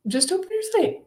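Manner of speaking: high, sing-song coaxing voice. Retold or imitated. Spiritual team nudging her to look, high mock coaxing.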